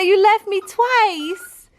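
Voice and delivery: high, slow, pouty dog voice; voicing the dog. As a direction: whine it for the dog, drawn out. Voicing the dog's complaint.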